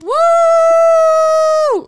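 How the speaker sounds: loud high whoop of joy; cheering woo. Real, not acted. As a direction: let out a big celebratory woo. Cheering the milestone.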